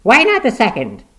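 high, loud urgent question; feverish. A narrator's reading. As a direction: press him with feverish hope. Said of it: "woman feverishly".

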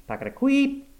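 chugging that leaps to a high squeak; imitated machine noise. A narrator's reading. Narrator imitating the failing machine, high-pitched squeak. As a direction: mimic a faulty machine squeaking. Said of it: "which was now going".

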